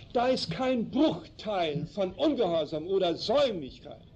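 normal talking voice

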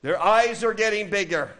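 high, loud and agitated. Shown as a demonstration. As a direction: build the heat of an angry person. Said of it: "starting to raise their voice". (aufgebracht, mit erhobener Stimme)

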